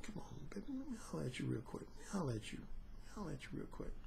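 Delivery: hushed, low seductive murmur; whispered sweet talk from a slick seducer; smooth serpent. Retold or imitated. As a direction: coax her in a near whisper. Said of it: "that serpent was smooth".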